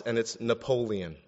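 usual speaking voice

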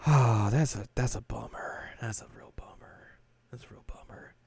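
sigh, then fade to a whisper; mock-dejected breathy murmur; deflated disappointment. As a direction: trail away into tiny crestfallen whispers. Acts Kagome's deflated disappointment.

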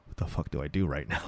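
very low, hushed inner voice; private panicked thought. Retold or imitated. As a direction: imitate his secret thought under his breath. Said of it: "in real life he's just like".

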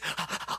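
quick panicky panting; frantic gasps. Acted. Preacher mimicking a frantic search for God, panicked gasps. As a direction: gasp in mock panic. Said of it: "frantic thing".

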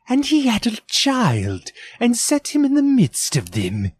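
put-on boy reading aloud. A narrator's reading. Peter reads the Bible to his family, high boyish voice. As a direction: read it out like a young boy at his book.

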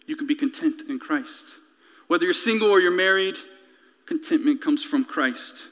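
low, hushed preaching; sermon's quiet close. Real, not acted.

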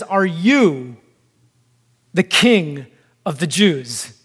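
"you" leaned on and held, then a pause; stress on YOU. Acted. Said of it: "emphasis on the word you".

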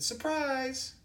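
high, drawn-out mock secretary; secretary's singsong announcement. Secretary tells caller the town's name, high singsong imitation. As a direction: sing it out high and loud, stretching the word. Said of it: "she said it just like that".

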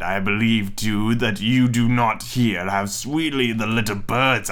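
low, slow coaxing wolf; sly wolf voice. Acted. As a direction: tempt her slowly like a wily wolf. Wolf tempts the girl.

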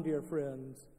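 normal talking voice

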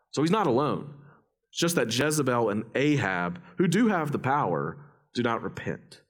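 normal talking voice

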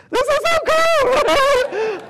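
very high, loud, slow and laughing; bursting with delight, dragging it out; thrilled. Real, not acted. Delighted at translated conversation.

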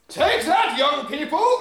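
very high, loud victory taunt; mock triumphant shout. Old man driving past the young driver, triumphant and loud. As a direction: crow it as you drive off. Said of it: "and he goes".

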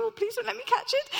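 high, loud, fast mock alarm; hammed-up dread of catching the infection; mock panic. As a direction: squeal the thought in comic panic. Inner thought avoiding infected hug.

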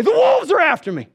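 high, loud cry of terror; terrified cry. Retold or imitated. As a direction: shout out a nightmare. Dying Stalin cries out in terror.

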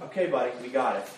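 mock weary dismissal. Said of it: "you just".

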